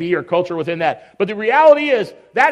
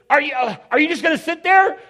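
high-pitched, put-on exasperation; exasperated; 8 semitones higher than usual. Spouse confronts partner who just sits, exasperated. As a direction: mimic a fed-up spouse.

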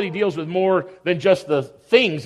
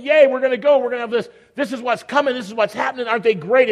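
excited, higher-pitched cheering; thrilled; 4 semitones higher than usual. Acted. Both sisters see Jesus coming, thrilled. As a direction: bubble over about company coming. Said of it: "They're both excited. They're both thrilled".

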